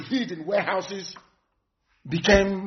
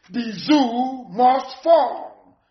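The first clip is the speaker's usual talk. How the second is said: very slow slogan; 2 semitones higher, much slower than usual. Real, not acted. Agitator repeating his vow to the crowd, very slowly drawn out. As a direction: drag the slogan out word by word.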